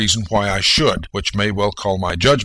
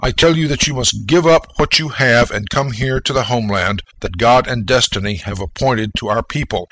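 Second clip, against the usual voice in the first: normal talking voice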